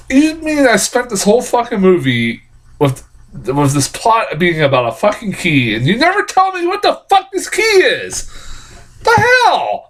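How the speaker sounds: high, exasperated outburst at the writers; indignant rant. Retold or imitated. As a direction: rant in disbelief. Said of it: "I'm like".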